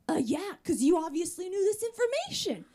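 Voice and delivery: loud, high-pitched indignant retort; upset. Retold or imitated. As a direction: act out an exasperated comeback. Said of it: "Wait, you're upset?".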